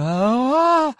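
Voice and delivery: sudden high cry of alarm; startled cry. A narrator's reading. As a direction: yelp as the ship lurches. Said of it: "ended in a startled cry".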